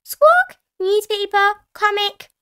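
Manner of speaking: high, loud parrot squawk; Polly Parrot. A narrator's reading. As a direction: repeat it back as a squawking parrot. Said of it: "Polly Parrot copied".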